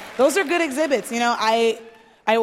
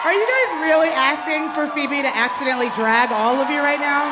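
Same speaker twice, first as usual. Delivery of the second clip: calling out; 3 semitones higher than usual. Real, not acted. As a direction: raise the voice at the room. Calling out to the audience.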